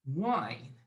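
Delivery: low, soft, drawn-out word; slow dictation. Shown as a demonstration. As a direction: say it slowly for someone writing it down. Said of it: "First time I'm gonna say it slow".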